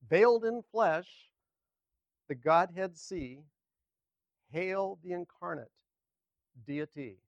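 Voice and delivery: slow, emphatic hymn verse read aloud; hymn recitation. Acted. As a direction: declaim each phrase with strong openings and long pauses.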